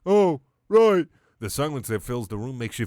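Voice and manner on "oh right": higher, louder dopey starfish voice; Patrick voice. Acted. Starfish realizing he has no job, dopey cartoon voice.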